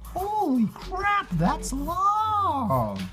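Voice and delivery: squeaky high shocked outburst; high comic disbelief, long and slow; mock shock. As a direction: gasp it out in a cartoon squeal. Shocked at flight length.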